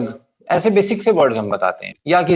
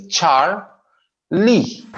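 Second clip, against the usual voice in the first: "चार", a pause, then a raised "Lee"; two syllables; 3 semitones higher, slower than usual. Shown as a demonstration. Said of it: "only two syllable". ("चार, Lee" रुककर दो टुकड़ों में)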